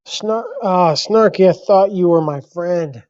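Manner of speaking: hammed-up wounded outcry; mock betrayal. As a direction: call out a friend's betrayal in jest. Mock-betrayed protest to a commenter.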